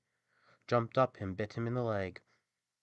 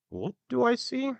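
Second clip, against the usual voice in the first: high, loud donkey character voice; a storybook donkey echoing the question; 7 dB louder than usual. A narrator's reading. Donkey answers the rooster from the window, high character voice. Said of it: "the donkey replied".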